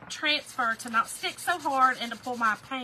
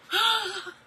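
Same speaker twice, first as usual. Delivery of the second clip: imitated gasp; 9 semitones higher, 6 dB louder than usual. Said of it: "he'd be like".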